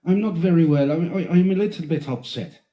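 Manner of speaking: lilting Irish complaint; Irish lady impression. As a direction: play a mildly upset Irish woman. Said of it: "She's like".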